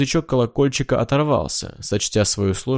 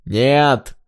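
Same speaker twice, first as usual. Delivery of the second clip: short higher-pitched cry of refusal; shouted refusal; 4 semitones higher, 4 dB louder than usual. A narrator's reading. Hanna cries out while still ringing, defiant. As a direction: call it out defiantly. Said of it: "выкрикнула Хана".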